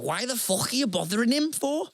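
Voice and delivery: high, annoyed Scouse woman; Scouse older woman. Retold or imitated. Annoyed Scouse museum worker scolding fans for recognising him, high Scouse voice. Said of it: "You're getting better at that impression".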